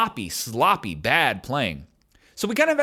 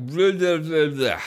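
slurred babble; slower than usual. Retold or imitated. Asking the huge bouncer for directions, in slurred mock babble. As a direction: imitate drugged mouth noises with no real words. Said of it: "it came out like".

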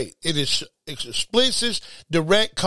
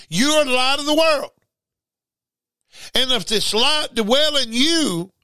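high, ringing quote of scripture; lifted, emphatic pulpit voice; fervent preaching; 7 semitones higher than usual. Acted. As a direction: proclaim it up high.

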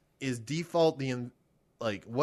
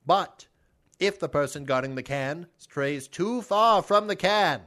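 put-on loud old-timey announcer, pitched up; 4 semitones higher, 4 dB louder than usual. Explaining kick the can to Kevin on request, mock old-timey voice. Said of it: "explain to me kick the can in an old-timey voice".

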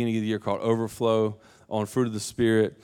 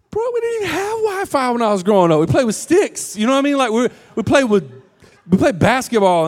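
much higher, louder mock-indignant rant; dad rant; 12 semitones higher, 5 dB louder than usual. Dad ranting about his childhood.

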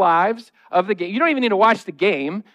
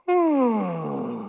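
yawning sigh; 5 dB quieter than usual. Shown as a demonstration. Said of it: "Maybe a yawn".

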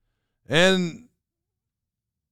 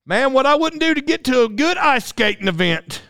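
mock yearning in a country accent; put-on country talk; 10 semitones higher than usual. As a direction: play an over-eager country fan. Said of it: "when I started talking like that".

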